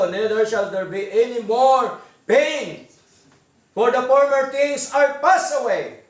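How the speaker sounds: slow, measured reading of the verse; slow, solemn sermon recitation; solemn scripture reading. Acted. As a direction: read it out word by word with weight. Reads scripture slowly.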